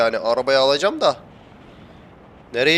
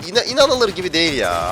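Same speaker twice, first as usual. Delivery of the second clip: stammered start, high swoop falling away; incredulous, high-pitched complaint; exasperated disbelief; 5 semitones higher, 3 dB louder than usual. Real, not acted. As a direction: wail it in disbelief.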